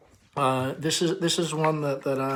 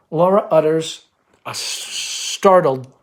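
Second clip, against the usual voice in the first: normal talking voice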